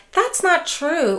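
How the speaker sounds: a touch of wounded denial; mock defensive denial. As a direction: play the stung relative pushing back. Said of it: "avoid saying things like".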